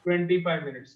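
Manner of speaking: normal talking voice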